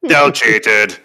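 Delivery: booming luchador announcer; Mexican wrestler voice. Pretend. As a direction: growl it like a masked wrestler. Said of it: "in your very best Mexican wrestler voice".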